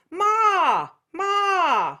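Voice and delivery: "Ma" high and nasal, called out; a bright, nasal "Ma". Shown as a demonstration. Teacher showing the nasal version of ma for contrast. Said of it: "that nasal thing we just did".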